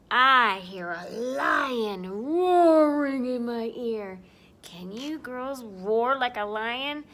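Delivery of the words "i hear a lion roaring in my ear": loud, slow, stretched-out words; booming storytelling. Pretend. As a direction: draw out the roaring loudly. Animal line read to children.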